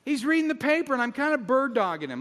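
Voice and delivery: loud exasperated storytelling; indignant rant. Acted. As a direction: complain in comic outrage.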